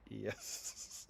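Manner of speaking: very quiet, low, toneless admission. Retold or imitated. Film star conceding he is only a voice, very quiet and low. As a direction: shrink the answer to almost nothing. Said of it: "And he goes".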